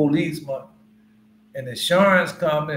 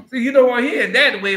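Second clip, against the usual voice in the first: emphatic call-out; 8 semitones higher, 8 dB louder, faster than usual. Real, not acted. Preacher calls out to congregation.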